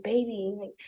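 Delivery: lightly imitated drunk boyfriend; drunk pleading. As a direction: slur it like one shot too many. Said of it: "it's like he's drunk".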